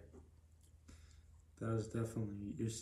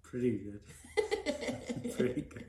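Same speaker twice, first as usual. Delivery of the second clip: a slow, laughing "pretty good"; 4 dB louder, much slower than usual. Real, not acted. Son laughing as he tells mom he's doing well. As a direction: chuckle the words out.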